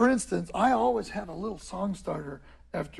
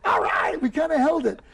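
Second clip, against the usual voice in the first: pleased self-congratulation; 6 semitones higher, 3 dB louder than usual. Acted. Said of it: "or you go".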